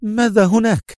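high, anxious question; nervous, pitched well up; tense Mona. A narrator's reading. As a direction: ask urgently in a high voice. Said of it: "سألته منى في توتر".

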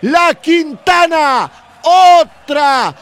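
high, loud, excited goal call; breakneck goal commentary. Real, not acted. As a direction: keep the goal euphoria going at full voice. Goal commentary after the score. (relato de gol a los gritos)

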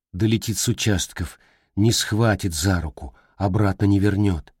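usual speaking voice

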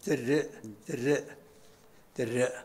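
دريء as dirri', closed on a glottal stop; i and final hamza. Shown as a demonstration. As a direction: say دريء with i after the d and a hamza to end. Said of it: "بكسر الدال والهمزة". (دريء بكسر الدال والهمزة)

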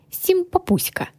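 high, cutesy, mocking little-dragon voice; snide. A narrator's reading. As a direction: tease in a high mock-sweet voice. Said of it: "ехидно протянул хранитель". (ехидно, тоненьким голоском)

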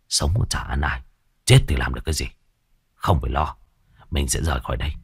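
low, soft male crook voice; reassuring gangster lover. Acted. Killer telling his lover to forget their victim, reassuring. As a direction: talk her worries down, low and soft. Man soothes worried lover.

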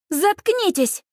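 high-pitched exasperated snap; fed-up, high outburst; furious shut-up. A narrator's reading. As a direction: snap at the jeering boys. Furious mother silencing jeering boys.

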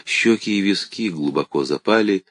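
usual speaking voice

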